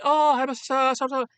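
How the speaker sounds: loud, high mock Japanese accent; hammed-up Japanese hibachi chef; heavy Japanese accent. Hibachi chef greeting customers, heavy mock Japanese accent. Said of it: "you know, a very heavy accent".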